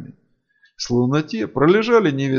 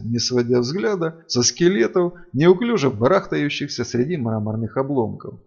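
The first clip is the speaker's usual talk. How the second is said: plain reading voice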